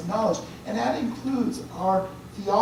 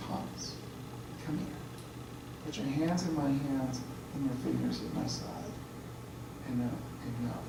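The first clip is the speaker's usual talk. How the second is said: hushed, lower, softer tender call; gentle invitation; 6 semitones lower, 11 dB quieter than usual. Retold or imitated. Jesus inviting doubting Thomas to touch him, gentle and hushed. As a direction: coax him close without blame.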